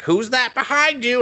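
high-pitched bird impression; talking bird. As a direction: imitate a bird blurting out a question. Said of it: "the bird is just like".

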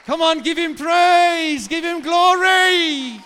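shouted, high, hyped-up praise; halftime pep speech. Real, not acted. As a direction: whip the crowd into a roar. Pastor hypes congregation to shout.

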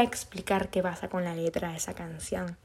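hushed confiding whisper to the viewers; soft whispered aside. Real, not acted. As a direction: whisper it like a secret.

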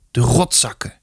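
subdued, hoarse curse. A narrator's reading. Sobbing boy finally speaking to his mother, hoarse. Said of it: "zei hij hees".

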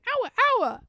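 very high-pitched little yelp; high imitation of a dog's wordlike bark; tiny human voice. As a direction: squeak it like a tiny person. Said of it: "it's like a tiny human going".

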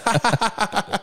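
carcajada aguda y suave; risa real aguda. Real, not acted. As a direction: se ríe a carcajadas. Laughing fit. (ataque de risa agudo)

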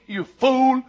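a hint of a TV tough-guy catchphrase; lightly imitated catchphrase; Mister T. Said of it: "you remember Mister T".

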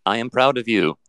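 synthetic robot voice; chatbot. Acted. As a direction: speak like a polite, literal machine. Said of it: "this is a chat bot".

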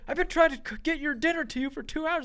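high, loud complaint; retold frustrated dad. Father complains to son about the dinner, loud and high. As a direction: vent the frustration at him.

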